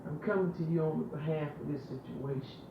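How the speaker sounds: soft, earnest prayer addressed to God; intimate prayer. Acted. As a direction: pray it close and devoted.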